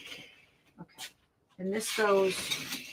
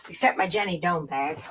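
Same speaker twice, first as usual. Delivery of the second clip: ordinary talk, nothing marked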